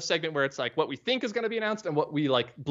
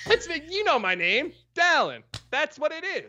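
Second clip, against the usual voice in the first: squeaky teasing voice; pitched way up, playful; high silly voice; 11 semitones higher than usual. Pretend. Mock high voice answering.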